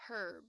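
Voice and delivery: herb with a strong sounded H; 英式herb，H发出来; British pronunciation. Shown as a demonstration. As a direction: breathe a clear H into herb. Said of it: "有很多H的音在里面哈".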